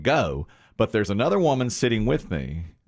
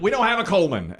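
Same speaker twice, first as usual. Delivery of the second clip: raised-pitch report from the back; high retold reply; imitated call back; 8 semitones higher than usual. Worker calls back, retold.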